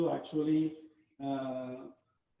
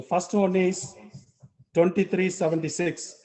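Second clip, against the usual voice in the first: raised voice for a far-off group; loud, clear repetition of numbers; speaking up loudly; 3 semitones higher, 8 dB louder than usual. Real, not acted. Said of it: "I will repeat it so you can hear it".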